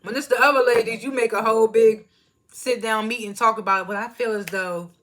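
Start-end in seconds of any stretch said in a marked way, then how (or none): 0.0-3.9 put-on loud, sassy girl-please retort; louder, clap back at her hypocrisy; exasperated loud reply to the TV; calling Sonya out. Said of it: "I was like".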